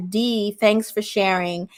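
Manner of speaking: normal talking voice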